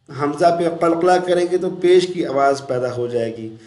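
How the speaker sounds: normal talking voice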